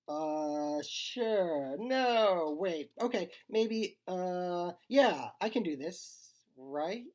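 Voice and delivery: stall, flip-flop, then talk yourself into it; nervous wavering. A narrator's reading. Leo wavering over a scary task.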